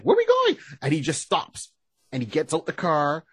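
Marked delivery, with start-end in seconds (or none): 0.0-0.6 high, loud questioning voice; high-pitched mock alarm; Batgirl. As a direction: ask in a high, alarmed imitation. Batgirl asking, acted in retelling.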